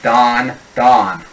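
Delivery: "Don" and "dawn" with the same aw vowel; Boston Don and dawn. Shown as a demonstration. Coach proving the two words merge, Boston "Don, dawn". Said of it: "pronounce them almost entirely exactly the same way".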